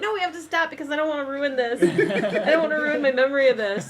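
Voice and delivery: high, fast panicked protest; frantic. Retold or imitated. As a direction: beg to stop, laughing. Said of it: "I'm like".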